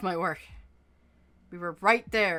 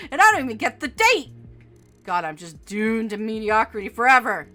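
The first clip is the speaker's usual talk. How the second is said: furious rant; 2 semitones higher, 9 dB louder than usual. Acted. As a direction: vent at full volume. Furious after failed interview.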